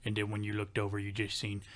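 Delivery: low, imitated country voice; deep country coworker. Imitating the coworker posing a random scenario, deep country voice. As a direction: pose it deep, in a thick country accent. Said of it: "he had a real deep down low voice".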